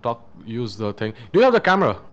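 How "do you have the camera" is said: urgent. Real, not acted. Urgent question during hunt.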